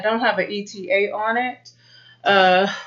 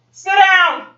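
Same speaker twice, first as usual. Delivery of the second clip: sharp, louder, higher dog command; 9 semitones higher, 4 dB louder than usual. Real, not acted. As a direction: bark the order at the dog. Commands barking dog again.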